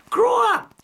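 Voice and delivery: high, loud angry shout; real angry outburst; furious shout. Real, not acted. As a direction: yell it at him in scorn. Furious rebuke of politician.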